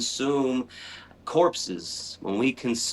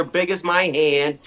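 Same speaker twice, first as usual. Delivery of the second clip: usual speaking voice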